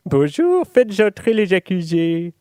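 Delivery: high, loud pompous judge voice. Acted. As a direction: boom out like a self-satisfied judge.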